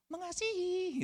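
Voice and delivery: high, feigned-innocent denial, said with a straight face; guilty lover's reply. Pretend. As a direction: play the caught partner lying smoothly. Cheating partner denies.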